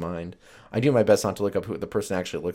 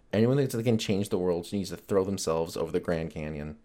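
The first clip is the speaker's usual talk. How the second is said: ordinary delivery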